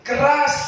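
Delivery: keras punched high and loud; stress on keras. Shown as a demonstration. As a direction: hit the word keras hard. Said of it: "semakin keras".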